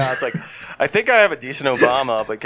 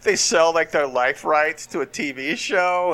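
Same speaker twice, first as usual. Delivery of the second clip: ordinary talk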